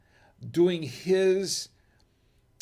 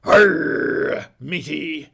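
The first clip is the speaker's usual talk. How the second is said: loud, drawn-out pirate growl; hammed-up pirate greeting; 10 dB louder, much slower than usual. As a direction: roar it like a movie pirate. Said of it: "that was his voice that everybody's tried to emulate".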